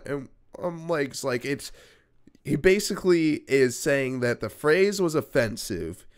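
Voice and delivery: ordinary talk, nothing marked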